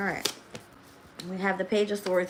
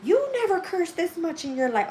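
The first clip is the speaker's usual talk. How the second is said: higher-pitched imitation of a scolding mother; mom scolding; 10 semitones higher, 3 dB louder than usual. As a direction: mimic mom nagging about swearing. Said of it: "my mom cursed me out".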